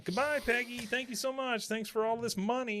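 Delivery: high, sing-song mock thanks; gloating doctor. Doctor leaving Peggy with the money, gloating. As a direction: taunt her with a lilting farewell.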